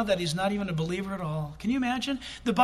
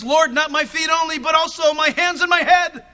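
louder and higher, pleading to be washed all over; overeager plea; 7 semitones higher, 9 dB louder than usual. Acted. Peter begging Jesus for more washing, loud and eager. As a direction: gush it out, wanting everything washed.

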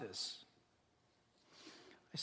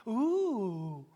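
drawn-out, louder mocking ooh; mock excitement; 2 semitones higher, 5 dB louder than usual. Preacher mocking people keen on worldly things, mock excitement. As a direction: coo in pretend thrill.

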